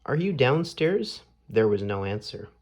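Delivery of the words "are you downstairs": calling out. A narrator's reading. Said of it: "he cried".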